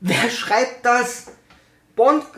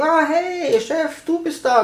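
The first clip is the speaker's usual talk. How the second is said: high, slow, fawning greeting to the boss; mock soldier greeting; 9 semitones higher, slower than usual. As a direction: gush a silly hello at the chief. Soldiers greet their boss, mocked. (hoch und langsam, übertrieben unterwürfig)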